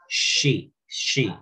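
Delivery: "she" repeated with a clear sh; pronunciation model. Shown as a demonstration. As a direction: say "she" slowly, sh then ee. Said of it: "este es she".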